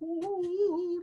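high wavering "ooh"; rustling grass noise. Acted. Showing visitors grass moving as tarantula flees, high imitated whoosh. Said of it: "see the grass kind of go".

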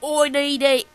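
badly faked, unplaceable South African accent; loud stab at a South African accent; South African accent attempt. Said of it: "what accent is that?".

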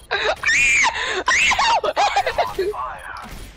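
very high squealing cackle; shrieking laugh. Real, not acted. Cracking up after a mishap in game, shrieking laugh.

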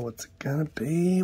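unvoiced suspense whisper. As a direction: whisper it before the reveal. Suspense before a foil reveal.

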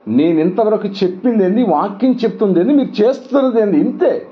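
put-on father rebuking his crying children, pitch climbing; scolding children. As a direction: build the scolding as each question climbs.

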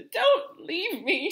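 very high, loud, drawn-out wail; panicked plea. A narrator's reading. Neville begs his departing friends, panicked. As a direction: cry out pleadingly, stretched and high.

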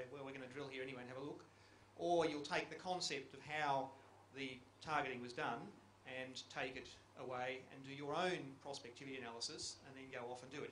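usual speaking voice

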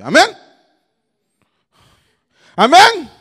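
high, loud call and count; rally the crowd for another try; booming cue; rousing shout. Real, not acted. Rallies crowd and starts count again.

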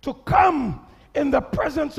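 loud, high, slow preaching. Acted. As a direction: shout it out to the church. Preaching at full force.

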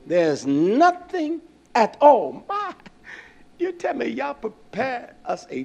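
slow, dragged-out mock whine; mock whiny complaint. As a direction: drag it out like a sulking people. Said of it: "that's the way they said it to Yah".

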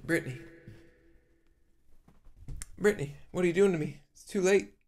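ordinary talk, nothing marked

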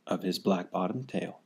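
plain reading voice

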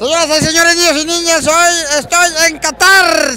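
high announcer call; ringmaster. Acted. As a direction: present it like a circus ringmaster. Announcer-style opening.

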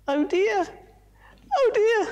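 very high, fretful oh dear; high frantic monkey voice; panicking monkey. Acted. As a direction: wring it out in rising panic. Moto panicking.

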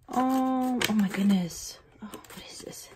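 moved whisper. Real, not acted. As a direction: whisper it, overcome by the gift. Moved, whispering over gift.

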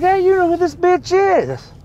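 high, drawn-out indignant demand; slow, high comic indignation; kinda pissed. Retold or imitated. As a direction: challenge them in a high, outraged voice. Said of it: "I'm kinda pissed".

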